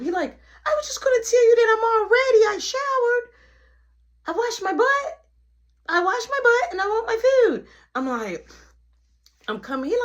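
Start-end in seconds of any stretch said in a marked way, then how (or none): 0.7-7.7 put-on high, loud kid voice insisting; little brother voice. Little brother announcing he's ready for food, high kid voice. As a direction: whine like an eager little kid.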